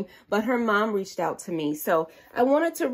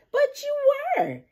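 much higher, insisting; insisting Susie; 3 dB louder than usual. A narrator's reading. Susie insisting to Peppa she was a baby, high. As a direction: squeak out the insistence. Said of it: "insisted Susie".

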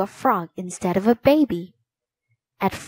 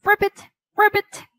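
high, loud frog croak; a little frog demanding, high and loud; 11 semitones higher, 3 dB louder than usual. A narrator's reading. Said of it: "the frog kept croaking".

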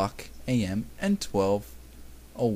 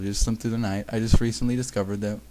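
ordinary delivery

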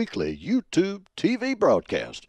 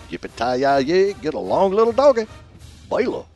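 playful cowboy call; 4 semitones higher than usual. Pretend. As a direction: sing out a mock cattle-drive holler. Cowboy call in a football pick.

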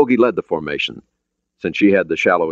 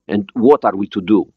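put-on slightly raised Russian-accented question; foreign accent; 4 semitones higher, 3 dB louder than usual. Borodin asking for orders, Russian accent. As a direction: ask it in careful Russian-accented English. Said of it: "He had an accent, but his English was understandable".

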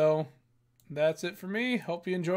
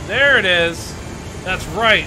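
triumphant shout; 2 semitones higher, 10 dB louder than usual. Real, not acted. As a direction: cheer the victory out loud. Boss finally defeated.

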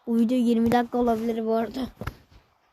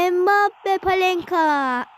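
drawn-out commentator call; 7 semitones higher, 3 dB louder, much slower than usual. Acted. Live football game commentary.

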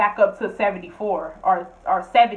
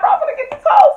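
much higher, louder mock rant; mock angry roommate; 9 dB louder, faster than usual. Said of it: "She's like".

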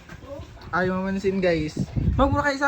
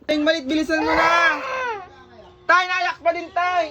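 higher-pitched urgent call; 5 semitones higher than usual. Real, not acted. Vlogger calls to dad about the crying child, urgent calling.

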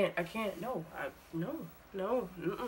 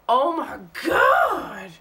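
loud, high cry of pain; pained shout; 6 semitones higher, 10 dB louder, slower than usual. Real, not acted. Shouting in pain as the mask rips off. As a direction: yell it as it rips.

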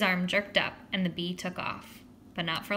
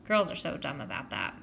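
ordinary delivery

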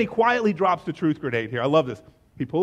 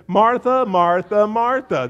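loud, sing-out name-calling; hammed-up exasperation on each repeated name; mock exasperated shout; 3 semitones higher, 8 dB louder, slower than usual. Preacher jokes with the sisters' photo, loud mock exasperation. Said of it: "photo of the sisters in the kitchen when he says it".

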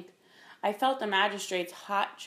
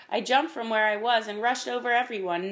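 ordinary delivery, nothing marked

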